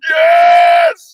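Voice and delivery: a very high, very loud cheer of "Yes!"; ecstatic shout. Retold or imitated. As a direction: yell it like you just joined the party. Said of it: "I ended up in there going".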